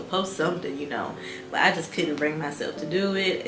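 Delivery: ordinary delivery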